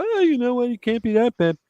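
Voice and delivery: high, sing-song mock gush. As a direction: play the starry-eyed fan. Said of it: "that they go".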